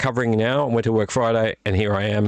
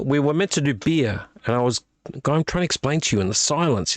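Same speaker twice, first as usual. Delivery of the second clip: ordinary talk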